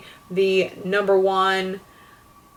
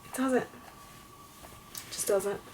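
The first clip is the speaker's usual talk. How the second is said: very soft, deflated answer; quietly fed up; resigned; 14 dB quieter than usual. Real, not acted. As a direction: drop the voice right down after the outburst. Answering own exasperated question.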